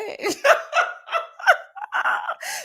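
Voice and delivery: long, very high cackling laugh; high laughter. Real, not acted. Cracking up at the orange flag, high laugh. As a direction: burst out in high-pitched laughter.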